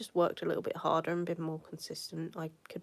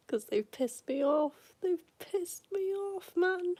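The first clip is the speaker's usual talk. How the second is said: upset; 11 semitones higher, slower than usual. Real, not acted. Ranting about BT while upset.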